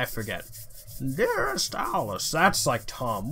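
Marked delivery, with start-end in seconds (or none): 1.2-2.3 very high, slow falsetto try; a high test take of a character voice; high squeaky letter voice. Shown as a demonstration. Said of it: "what voice did I give this guy".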